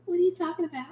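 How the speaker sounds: high, quick and soft; clueless and a bit lost; confused mom. Retold or imitated. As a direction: mom who hasn't caught the innuendo. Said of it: "my mom was like".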